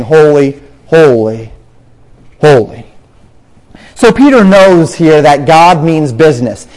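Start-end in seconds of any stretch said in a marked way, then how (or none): 0.0-2.9 "Holy, holy, holy" spaced out, dropping lower; emphatic repetition. Retold or imitated. Echoing the seraphim, each "holy" given separate, lowered emphasis. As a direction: set each "holy" apart with a pause, pitch sinking. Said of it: "what they were saying by saying".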